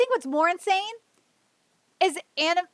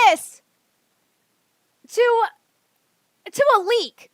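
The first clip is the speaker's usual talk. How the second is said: louder, higher cursing at the crowd; furious rant; 4 semitones higher, 4 dB louder than usual. Real, not acted. Streamer curses at fans going after Oda, furious. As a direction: spit it at them in disgust.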